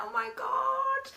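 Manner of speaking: high, drawn-out fangirl swoon; gooey, starstruck. Retold or imitated. She spots Pat at the party, gooey swooning. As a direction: melt at the sight of your idol. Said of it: "I went all gooey".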